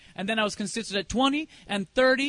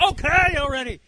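fed up with a nagging alarm, played for laughs; mock exasperation; 3 semitones higher, 6 dB louder than usual. Answering the watch that beeps all day, hammed-up exasperation. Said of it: "I'm like".

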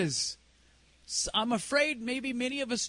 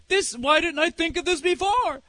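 higher-pitched, exclaiming disbelief; amazed realization; 6 semitones higher than usual. Retold or imitated. Church elders trying the experiment, amazed exclamation. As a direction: imitate someone kicking themselves at a discovery. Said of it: "and they're going".

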